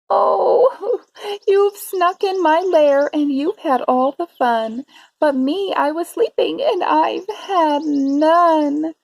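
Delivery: high, loud storybook wail; blubbering bear. A narrator's reading. Bear complaining to party guests in his lair, hammed-up wailing. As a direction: moan and whine like a left-out bear. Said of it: "he whimpers and he moans and he wails".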